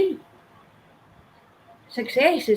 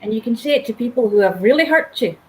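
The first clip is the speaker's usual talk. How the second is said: usual speaking voice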